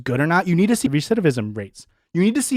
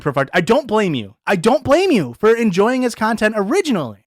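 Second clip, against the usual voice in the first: normal talking voice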